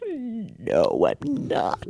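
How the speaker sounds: imitating a soft, crying no; imitating the crying woman's quiet tearful answer; tearful reply. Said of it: "And she was like".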